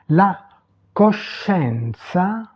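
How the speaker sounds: "La coscienza" very slow, pause after La; teacher modelling "La coscienza" piece by piece; Italian pronunciation demo. Shown as a demonstration. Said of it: "prononcer les mots". (prononcer « La coscienza » très lentement, à l'italienne)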